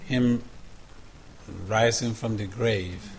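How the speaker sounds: soft, low, reverent prayer voice; hushed prayer. Acted. Pastor praying over the congregation, hushed and reverent. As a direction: pray quietly and humbly to God. Opening prayer before the sermon.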